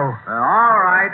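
ordinary talk

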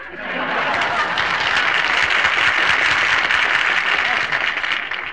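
high unvoiced triumphant cackle; loud gleeful cackle. Old marshal laughing at his own brag, loud cackle. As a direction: crow with laughter at himself.

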